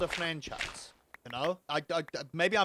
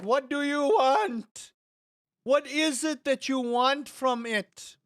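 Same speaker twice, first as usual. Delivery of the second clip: loud, high, drawn-out plea; exasperated demand; 9 semitones higher, 7 dB louder, slower than usual. Acted. Streamer pleading with never-satisfied gamers, exasperated. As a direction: cry out in hammed-up frustration, stretching the words. Acted quarrel with fickle gamers.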